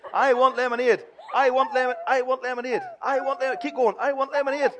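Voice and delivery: put-on high, loud repeated child whine; whiny child demand. Shown as a demonstration. Minister voices the child's nagging for lemonade, high whine. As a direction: nag on and on like a child. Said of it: "what would you say at home".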